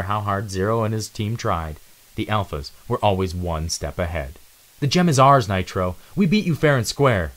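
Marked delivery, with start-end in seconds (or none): 4.8-7.3 louder, higher firm protest; defiant. Acted. Zero insists to Nitro they won fairly, louder. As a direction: stand your ground. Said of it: "Zero defies Nitro".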